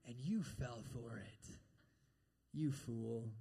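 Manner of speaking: low, slow, hushed contempt; the devil. Acted. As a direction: drop soft and low to shame them. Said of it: "he heaps the shame on you".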